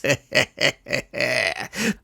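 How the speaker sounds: quiet sly villain chuckle; the skull chuckling at his plan; soft chuckle. Acted. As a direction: chuckle softly to yourself. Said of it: "chuckled softly".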